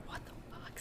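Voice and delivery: a voiceless whispered curse; shocked whisper under the breath. Retold or imitated. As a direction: mouth it, barely a breath. Stunned reaction after getting home.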